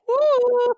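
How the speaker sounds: a sudden high, loud "woo" of mock celebration; mock cheer. Sister cuts her sad journal reading with a loud mock woo. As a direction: whoop it out like a giddy cheer.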